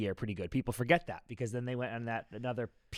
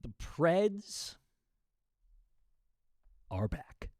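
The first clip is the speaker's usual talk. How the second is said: dramatic announcement; 4 semitones higher, much slower than usual. Acted. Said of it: "will you relax?".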